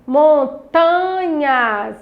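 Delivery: MONTANHAS very slow, TA held long; syllable stress demo. Shown as a demonstration. Teacher shows children the tonic syllable, Montanhas drawn out on TA. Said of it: "é a sílaba tônica da palavra".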